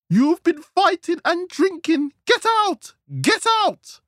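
very high, loud wifely shout; angry shout. A narrator's reading. Wife throws her drunk husband out, shouting. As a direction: yell it high, building to the order. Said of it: "Trina shouted".

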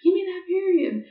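high sarcastic plea to God; girl mocking the idea of praying for her period, high and sarcastic; mock prayer. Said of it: "praying to God".

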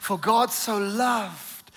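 slow phrase, landing on a long "loved"; "loved" held and falling, deliberately slow; stress on LOVED. Shown as a demonstration. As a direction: stretch out "loved" for emphasis. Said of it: "I want to emphasize that".

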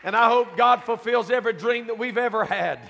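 loud, high, building preacher's cry; preaching at full force. Real, not acted. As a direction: climb to a shouting climax about God's presence.

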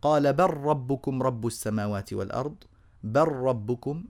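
recited with بل ربكم as "bar-rabbukum"; long doubled r, no trace of l in بل; اللام merged into الراء. Shown as a demonstration. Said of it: "بر من باء إلى راء مباشرة". (إدغام لام "بل" في راء "ربكم")

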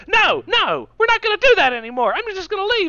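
high, loud indignant imitation; Ben Shapiro. Guest refusing to continue the interview, high-pitched mock outrage. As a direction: squawk a hammed-up refusal. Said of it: "And Shapiro's like".

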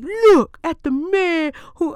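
high, slow, proclaiming Samuel; Samuel's put-on prophet voice. Preacher voicing Samuel presenting Saul, high and grand. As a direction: unveil the new king with grand pomp. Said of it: "I don't know why I'm giving Samuel that voice".

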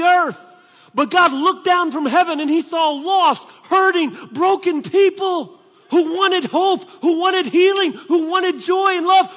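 high, loud, impassioned shouting; full-force preaching. Real, not acted. Preacher proclaims God rescuing broken people, shouting with fervor. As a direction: proclaim it to the back of the church.

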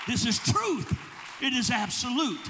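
fervent sermon climax; full-force preaching. Acted. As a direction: build the congregation up. Preaching over music.

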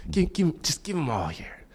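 quiet, breathy-free eager giving voice; lightly voiced eager friend begging for problems. Friend begging to take your problems, softly eager. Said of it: "that's just like".

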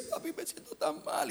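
put-on high, soft child's voice; small, weak voice of an ill child; sick little boy. Sick boy complains to his father, child voice.

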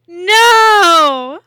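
a full, loud cry of protest; outraged shout. Real, not acted. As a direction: shout it in disbelief. Outraged reaction to kids' beer.

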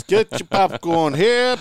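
loud, high ballpark vendor call; stadium vendor hawking. Pretend. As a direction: holler it like a peanut vendor.

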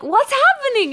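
high, loud startled cry; panicked call from the other room; alarmed shout. Retold or imitated. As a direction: yell out in sudden alarm. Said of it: "And I'm like".

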